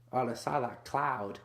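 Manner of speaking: ordinary delivery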